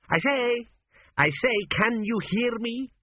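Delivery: the ghost calling to his deaf son, high; calling ghost king. A narrator's reading. Ghost calls out.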